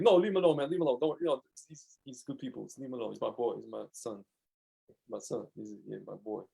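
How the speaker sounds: calming the gang down, ending almost hushed; protective kid, fading. Retold or imitated. Kid shielding him from the gang, fading to soft hush.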